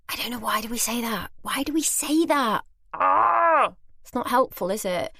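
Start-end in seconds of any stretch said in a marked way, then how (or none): 0.1-3.7 high-pitched rising frustration; exasperated. Real, not acted. Storyteller cringing at her own apology, exasperated.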